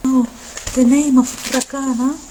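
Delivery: normal talking voice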